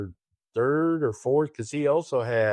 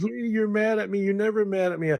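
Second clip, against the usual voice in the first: high-pitched mock friend's voice; imitating Marcia; 9 semitones higher than usual. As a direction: pitch it way up to play the friend teasing. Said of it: "she calls me and she says".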